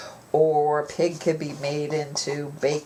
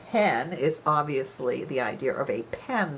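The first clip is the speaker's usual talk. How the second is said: usual speaking voice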